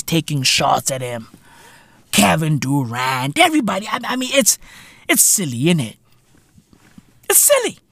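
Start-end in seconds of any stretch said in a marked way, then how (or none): none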